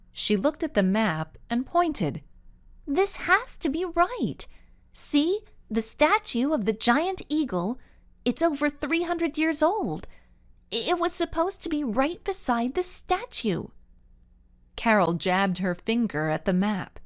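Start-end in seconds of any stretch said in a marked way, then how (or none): 2.9-13.7 a hint of a higher, pleading young voice; insist, growing unsure; lightly voiced girl. A narrator's reading. Carol pointing at the map, desperately insisting.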